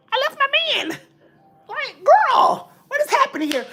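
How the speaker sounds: hammed-up high wail of disbelief; high-pitched disbelief. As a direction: squeal it in mock astonishment.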